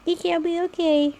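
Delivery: ordinary talk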